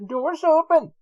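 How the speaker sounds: very high, loud announcer imitation; exaggerated high announcement voice; mock lift announcement. Retrying the mimicked lift announcement.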